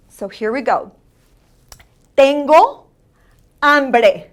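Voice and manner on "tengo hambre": "Tengo" louder and rising, pause, "hambre" falling; loud, separated teacher's model of "Tengo hambre"; accentuated Spanish vowels. Shown as a demonstration. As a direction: punch the vowels in "Tengo hambre" for learners to repeat. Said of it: "vowels are very important that you accentuate".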